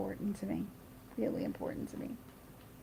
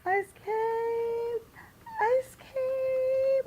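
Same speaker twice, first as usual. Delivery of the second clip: put-on very high toddler squeal, long-held vowel; Alexa; much slower than usual. Two-year-old in the stroller demanding ice cream, imitated high scream. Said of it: "then Alexa starts screaming".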